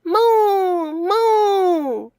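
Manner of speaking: put-on high, loud playful child's moo; shouting moo. Max shouting moo at the cow, loud and high. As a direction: call it out like a boy to a cow. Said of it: "Max is shouting".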